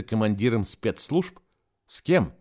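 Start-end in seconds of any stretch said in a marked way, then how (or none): none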